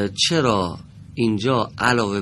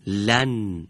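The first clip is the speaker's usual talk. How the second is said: ordinary talk, nothing marked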